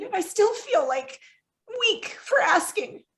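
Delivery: high, tearful, halting confession; real crying voice. Real, not acted. Guest admitting she feels weak for asking, tearful. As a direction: let it climb into tears, slowing at the end. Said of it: "like, a crying interview".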